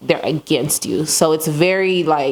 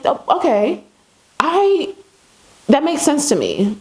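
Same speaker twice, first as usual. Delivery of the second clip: surprised realisation; 10 semitones higher than usual. Retold or imitated. Sudden realisation about creator's idea.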